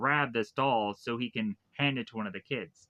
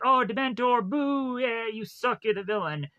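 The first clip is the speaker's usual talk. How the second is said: raised-pitch pretend jeers, loudest at the start; imitating jeering crowd; 9 semitones higher than usual. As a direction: heckle a stage villain like a whole crowd.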